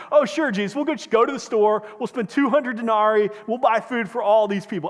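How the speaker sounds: loud, high, scoffing sarcasm; sarcastic snap. Retold or imitated. Disciples scoff at Jesus's command, loud and sarcastic. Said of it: "they sarcastically just snap back".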